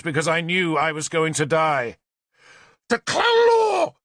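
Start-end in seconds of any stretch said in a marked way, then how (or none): 2.9-3.9 very high, slow, loud invocation; ritual shout. A narrator's reading. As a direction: scream the god's name over the fires. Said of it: "Tanabor shouted".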